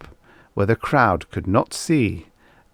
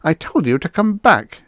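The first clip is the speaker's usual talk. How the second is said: sharp, higher scolding; snapping mother; 9 semitones higher, 5 dB louder than usual. Acted. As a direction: tell the child off curtly. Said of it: "she snapped at the little girl".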